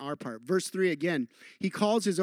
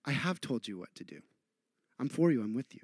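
soft voice of Jesus; 5 semitones lower, 7 dB quieter than usual. Retold or imitated. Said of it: "he's like".

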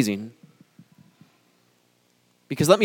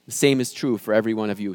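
ordinary talk

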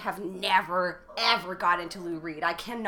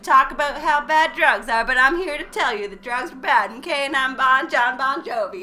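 higher, louder rock star voice; [name] voice; 5 semitones higher, 9 dB louder than usual. Retold or imitated. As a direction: play the cheesy rock star in an ad. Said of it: "he's like".

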